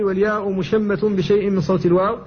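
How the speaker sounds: ordinary delivery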